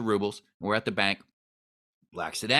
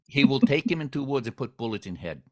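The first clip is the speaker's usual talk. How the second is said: put-on hammy Russian gangster accent; broken English, Russian accent; 2 semitones higher than usual. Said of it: "my really bad Russian accent".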